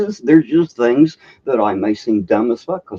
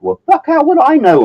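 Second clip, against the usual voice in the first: much higher and louder mock outrage; indignant retort; 6 dB louder than usual. Customer retorting to the rude clerk, mock indignant. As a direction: fire back in retold disbelief. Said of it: "And I'm like".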